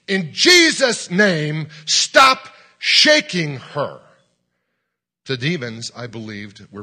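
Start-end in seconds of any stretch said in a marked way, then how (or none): 0.0-4.0 loud, high, slow shout; top-of-the-voice command. Retold or imitated. Preacher commands demons to stop shaking a girl, shouting at full voice. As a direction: bellow each word slowly as a command. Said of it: "at the top of my voice".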